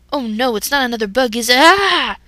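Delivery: panicked yelp rising to a loud scream; dread, then scream in fright; boy spotting mistletoe, screaming. Acted. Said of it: "Ash screamed".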